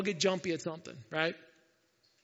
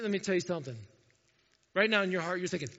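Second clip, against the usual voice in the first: ordinary talk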